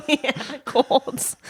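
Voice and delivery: put-on Jersey accent, Quald for called. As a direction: say Quald like a New Jersey local. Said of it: "My Jersey accent".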